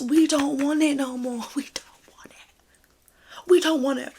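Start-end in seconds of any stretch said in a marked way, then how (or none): 0.0-1.4 slow breathy whisper, higher pitch. Real, not acted. Ranting about the reality show. 1.6-3.5 very slow near-silent whisper. Real, not acted. Repeats the line, then says it plainly.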